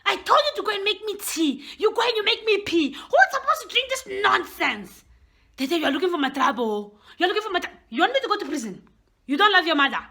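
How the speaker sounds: scolding mother. Acted. Mother scolding daughter over the tea, angry rant.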